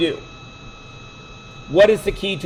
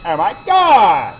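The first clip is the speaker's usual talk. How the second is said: mock exasperated student; 2 semitones higher, 5 dB louder, slower than usual. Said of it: "all of you are gonna".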